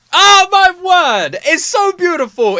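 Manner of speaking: high, loud swoon over the song; rapturous gush. Real, not acted. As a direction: squeal like the lyrics just melted you. Overwhelmed by the lyrics.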